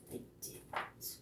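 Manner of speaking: breathless whisper; hushed whispered repeat. Shown as a demonstration. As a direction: whisper it like a secret. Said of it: "repeated line, dropped to whisper".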